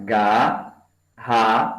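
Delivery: garrafa split slowly as ga-rra-fa; slow segmenting demo for the class; syllables said apart. Shown as a demonstration. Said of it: "agora é separar".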